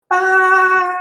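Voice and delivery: high, loud, drawn-out ah; high sighing ah. Acted. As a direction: let out a dramatic high ah. NPC voice sighs on phone.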